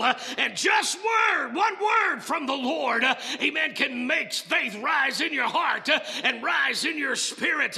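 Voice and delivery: loud, high, rhythmic preaching; impassioned sermon climax; preaching at full force. Acted. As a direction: drive it home to the congregation.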